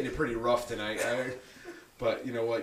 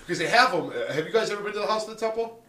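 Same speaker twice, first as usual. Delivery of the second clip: usual speaking voice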